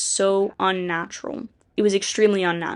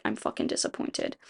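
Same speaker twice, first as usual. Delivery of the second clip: muttered under the breath, much softer; disappointed whisper; 8 dB quieter than usual. Retold or imitated. Loki served tea, grumbling under his breath, much softer. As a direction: breathe it out like a sulking god.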